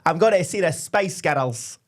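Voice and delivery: slow, drawn-out Scottish accent. Shown as a demonstration. Co-host contrasting Scottish with American, slow Scottish accent. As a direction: say it slowly as a Scot would. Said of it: "if you're Scottish, you'd go".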